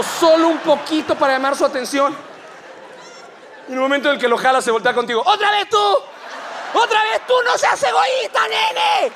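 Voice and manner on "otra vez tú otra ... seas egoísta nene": grito muy agudo y fuerte; grito furioso. Acted. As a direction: explota de furia contra la fiel. El Papa furioso regaña de nuevo. (el Papa otra vez furioso, gritando)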